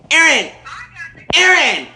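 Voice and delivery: loud, high shout for Aaron; Erkenbacher yelling. Acted. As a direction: bellow across the house for backup. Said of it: "Erkenbacher calling back".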